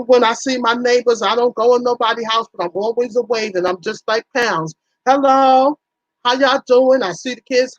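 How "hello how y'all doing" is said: cheery greeting call. Retold or imitated. Waving and greeting the neighbors.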